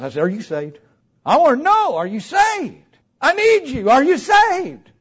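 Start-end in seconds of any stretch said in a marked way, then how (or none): 1.2-3.9 high, loud, insistent pleading; worked-up retold confrontation; urgent demand. Retold urgent questioning of wife. 3.9-4.9 high, loud, insistent question; worked-up retold plea; urgent questioning. Same question repeated more urgently.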